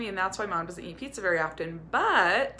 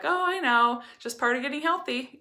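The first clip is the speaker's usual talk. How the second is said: higher and louder, brushing it off cheerfully; light and breezy; 7 semitones higher, 4 dB louder than usual. Retold or imitated. Mom answering her kids' comment, light breezy brush-off. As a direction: keep it airy so the kids don't feel bad. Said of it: "make it light and not make them feel bad".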